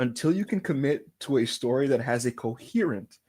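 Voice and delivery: low, soft, measured declaration; mock-solemn address. Fan spokesman appealing to the wrestler, mock-solemn. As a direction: deliver a formal appeal.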